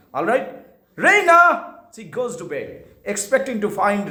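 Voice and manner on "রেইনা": high loud call of রেইনা. Shown as a demonstration. Mother calling for her daughter. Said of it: "এরভাবে ডাকছে".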